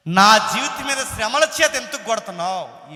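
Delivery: loud, high cry of complaint to God; shouted protest. Acted. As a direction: demand an answer at the top of your voice. Acted protest addressed to God. (దేవునితో గట్టిగా అరుస్తూ ఫిర్యాదు)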